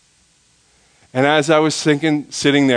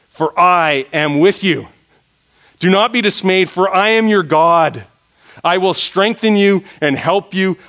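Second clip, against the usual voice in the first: raised, emphatic verse reading; scripture proclamation; 6 semitones higher, 5 dB louder than usual. Real, not acted. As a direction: proclaim God's promise with a lifted voice.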